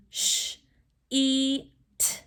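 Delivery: "Sh, e, t" held apart as single sounds; sounded out. Shown as a demonstration. Teacher has class join in, sounding out slowly. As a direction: say each sound of "sheet" separately. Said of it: "Do it with me".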